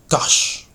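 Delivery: breath-driven, expressive GUSH; word-painting. Shown as a demonstration. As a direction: GUSH released in a rush of breath. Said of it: "bring out the meaning of the word by its sound".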